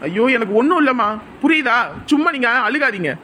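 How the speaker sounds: fake anger. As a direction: shout her off in put-on anger. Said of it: "கோவத்துல இருக்க மாதிரி நடிச்சா".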